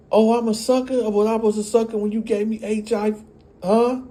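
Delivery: mock furious mother; mocking angry retort. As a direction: throw it in his face. She blurts out.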